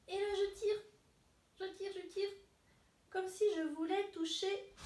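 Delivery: high, effortful stretching voice; straining to stretch. Pretend. Teacher mimes reaching for the ceiling, high strained voice. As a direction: pitch it up high as if reaching overhead. Acting a big upward stretch.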